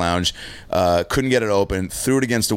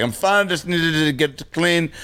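put-on higher, slow drunk insistence; drunk self; 8 semitones higher, slower than usual. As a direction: pretend you're totally fine while wasted. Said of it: "I'm like".

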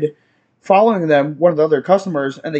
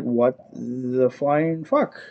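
slow, hushed, lower-pitched astonishment; stunned disbelief; 5 semitones lower, 7 dB quieter, much slower than usual. Retold or imitated. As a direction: draw it out slowly, softly, dumbfounded. Stunned reaction to the crash.

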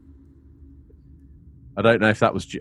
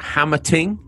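Guyanese accent; 7 semitones higher than usual. Shown as a demonstration. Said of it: "That's how my boss would say it".

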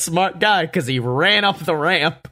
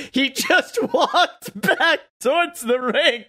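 high, slow, laughing through words; 12 semitones higher than usual. Real, not acted. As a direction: crack up while telling it. Cracking up telling the story.

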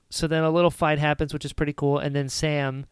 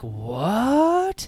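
high stretched disbelieving "What?"; rising, baffled groan of surprise; mock disbelief; 6 semitones higher than usual. Friends reacting to scene.

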